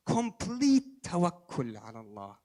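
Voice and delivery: ordinary talk, nothing marked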